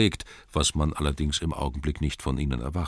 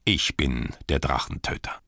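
proud declaration. Acted. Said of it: "rief er".

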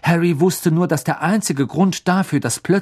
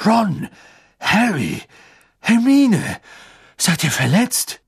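high, anxious calling out; worried father's urgent check; horrified concern; 7 semitones higher, 4 dB louder, slower than usual. A narrator's reading. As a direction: call each name in alarm.

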